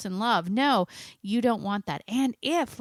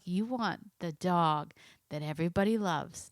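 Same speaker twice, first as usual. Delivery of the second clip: ordinary delivery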